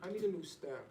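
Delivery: ordinary talk, nothing marked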